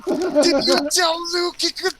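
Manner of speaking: high, drawn-out mock yelling; outraged yell. Confronting the one who gave Luke permission, outraged yell. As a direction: yell it slowly in high disbelief. Retells outraged question to Matt.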